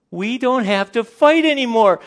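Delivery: high, loud mock-ecstatic cry; over-the-top celebrating couple; overjoyed shout. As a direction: burst out in hammed-up relief.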